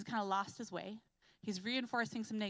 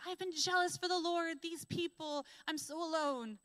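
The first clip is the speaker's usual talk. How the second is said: higher, pained complaint; Elijah's lament; 7 semitones higher than usual. Acted. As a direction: pour out a lonely grievance.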